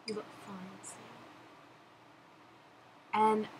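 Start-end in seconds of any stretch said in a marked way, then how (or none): 0.1-1.0 much softer, tender second read; a quiet, loving repeat of the line; Rosie's line, soft take. Pretend. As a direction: let it drop to a hush, meaning I love you. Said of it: "please say one of Rosie's lines".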